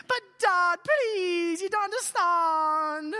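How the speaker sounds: high, loud hammed-up wail; whiny teen protest. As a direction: drag it out in mock desperate pleading. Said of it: "it adds to the whiny".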